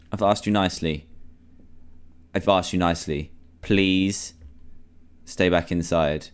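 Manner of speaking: low, measured, subdued plea; restrained pleading. Real, not acted. Player reasoning with Freddy to stay inside, restrained pleading. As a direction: plead quietly and low, leaving space between phrases.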